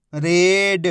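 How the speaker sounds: whole word in one joined, drawn-out piece; teacher's blended model reading; syllables blended. Shown as a demonstration. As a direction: join the sounds into one clear word. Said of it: "आपस में मिला के पढ़ना है".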